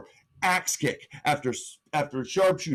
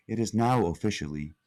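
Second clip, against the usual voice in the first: lightly imitated computer, pitched lower; 6 semitones lower than usual. Titantron announcing Hell in the Cell, lightly voiced computer. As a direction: drop low into a mock computer announcement. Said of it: "that weird computer voice".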